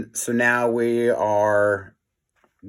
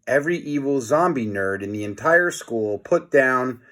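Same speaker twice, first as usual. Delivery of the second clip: usual speaking voice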